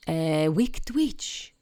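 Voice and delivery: plain reading voice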